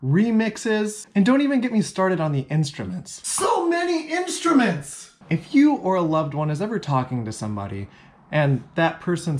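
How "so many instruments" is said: much higher, slow, overwhelmed; theatrical overwhelm; mock overwhelmed cry. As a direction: wail it like it's too much to bear.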